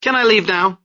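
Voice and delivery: a loser's angry demand to go; disgusted anger. A narrator's reading. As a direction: spit it out in disgust. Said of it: "said with disgusted anger".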